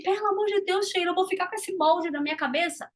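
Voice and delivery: mildly alarmed mock plea; worried listener. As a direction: play the anxious viewer. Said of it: "Aí você fala assim".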